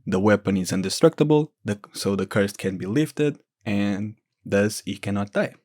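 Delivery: ordinary talk, nothing marked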